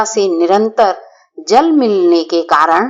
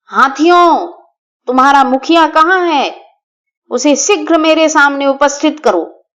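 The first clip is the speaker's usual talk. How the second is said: high, loud commanding call from a hilltop; 5 semitones higher than usual. Acted. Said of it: "और ऊंचे स्वर में बोला".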